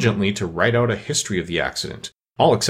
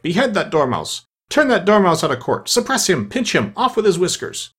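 string of high-pitched shrieked commands; high, forceful royal tirade; shrieking; 10 semitones higher than usual. A narrator's reading. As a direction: rap out orders as a shrieking queen. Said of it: "the queen shrieked out".

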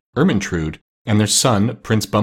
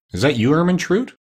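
higher-pitched drowsy question; lightly acted sleepy king; 7 semitones higher than usual. A narrator's reading. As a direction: ask as if just woken. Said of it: "said sleepily".

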